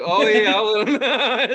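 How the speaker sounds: loud, high, laughing through the words; retold line dissolving into laughter; laughing reply. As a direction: crack up while quoting her.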